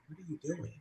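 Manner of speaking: very soft retold question; hushed disbelief. Onlookers watching her on the date, hushed disbelief. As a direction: quote it under the breath. Retells onlookers' silent reaction.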